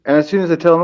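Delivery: usual speaking voice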